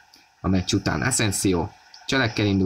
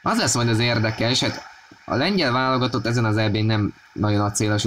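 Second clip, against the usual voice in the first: normal talking voice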